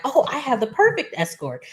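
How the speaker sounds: mock eager offer. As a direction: pitch it up as if proud of a bargain. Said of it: "Vanessa is just like".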